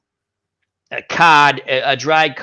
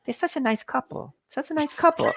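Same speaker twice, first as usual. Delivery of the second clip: put-on higher, much softer little old lady voice; old Vietnamese woman; 9 semitones higher, 9 dB quieter than usual. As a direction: coo it sweetly like a tiny old lady. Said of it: "she goes".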